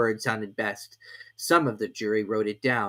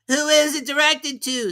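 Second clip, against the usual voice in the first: high, loud juror; juryman voice; 7 dB louder than usual. A narrator's reading. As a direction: ask as a juror. Juryman asks.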